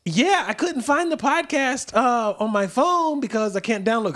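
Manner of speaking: high-pitched daughter voice; high little-girl voice. Retold or imitated. Father imitating his daughter, high childlike voice. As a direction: sound like a sweet twelve-year-old. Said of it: "she said".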